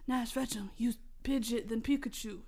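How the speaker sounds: low, hushed inner voice; mysterious voice. Acted. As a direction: speak softly and low, like an unseen guide. The voice advises the hero.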